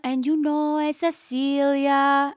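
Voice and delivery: rambling mock Italian-accented English; slow, drawn-out Italian grandma impression; imitated Italian grandmother. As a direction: ramble like an Italian nonna, stretching phrase ends. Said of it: "every three words Nonna says".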